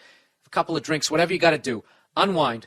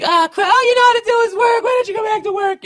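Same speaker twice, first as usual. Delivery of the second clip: much higher, louder screeching wife; shrieking nag; 8 dB louder than usual. Shown as a demonstration. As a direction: screech it like a furious nagging wife. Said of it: "if she said it like that".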